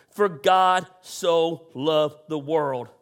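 low, slow and tender; hushed verse. Real, not acted. Pastor reminding the church of the verse, low and tender.

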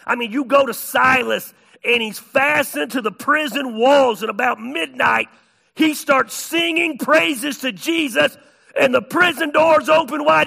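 loud, high-pitched sermon storytelling; full-force preaching. Real, not acted.

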